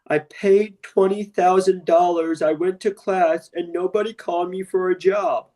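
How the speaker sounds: high, slow, breathy whimpering caller voice; mock the crying student who paid twenty grand; whiny caller. Mimicking a crying caller to his listeners, high and slow.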